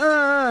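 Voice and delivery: high, loud mock wail; imitated pained scream. Man being battered on the ground, imitated scream. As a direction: cry out as if knocked down, acting it.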